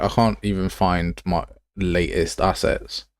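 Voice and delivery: ordinary delivery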